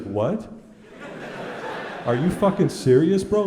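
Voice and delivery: imitating the friend's incredulous outburst, laughing; mock outraged disbelief. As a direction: blurt it out like you can't believe it.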